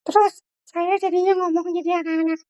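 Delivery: put-on high child voice on the teacher's own line; wrong-way child voice. Shown as a demonstration. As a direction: demo the mistake in a child voice. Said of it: "Jangan sampai kita jadi ngomongnya sebesar". (suara anak-anak yang salah tempat)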